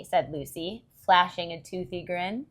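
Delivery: ordinary delivery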